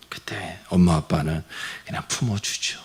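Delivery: soft hushed whisper; whispered tenderness. Real, not acted. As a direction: whisper it like an embrace. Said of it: "tender embrace described".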